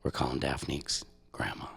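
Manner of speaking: hushed whisper before the call. Real, not acted. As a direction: whisper it so nobody hears.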